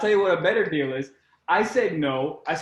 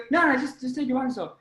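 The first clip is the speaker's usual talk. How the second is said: insistent creep; 8 semitones higher than usual. Retold or imitated. Retells photographer insisting.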